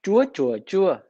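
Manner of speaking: "Chúa, chúa, chúa", each on its own tone; Vietnamese tone contrast. Shown as a demonstration. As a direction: repeat "Chúa, chúa, chúa" so the three tones stand apart. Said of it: "Can you hear the difference?".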